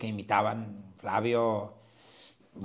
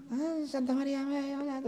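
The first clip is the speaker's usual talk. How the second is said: high pleading prayer voice, a mourner begging a saint; praying mourner; 14 semitones higher, 3 dB quieter than usual. Retold or imitated. Mourner at the funeral praying, high and pleading. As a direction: mimic a weeping woman crying out to Santa María. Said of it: "y rezando".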